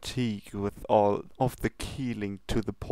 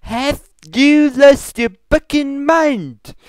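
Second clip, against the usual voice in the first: loud, slow, high outraged shout; furious yell; 13 dB louder, much slower than usual. Acted. Luna screams at Pinkie in outrage. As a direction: explode at her. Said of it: "Luna yells".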